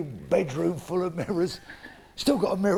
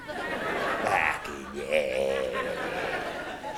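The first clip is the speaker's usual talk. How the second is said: gleeful; 4 dB quieter, much slower than usual. Retold or imitated. Gleeful reply to photo request.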